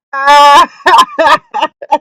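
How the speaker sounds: loud high laughing shout. Real, not acted. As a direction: burst out laughing loudly. Reacting to clipped moment.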